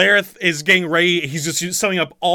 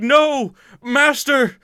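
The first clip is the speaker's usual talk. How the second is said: high, loud wail of grief; acted distraught henchman, pitched way up; anguished cry; 10 semitones higher, 4 dB louder, slower than usual. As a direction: cry out in desperate, hammed-up denial. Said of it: "the guy cries out".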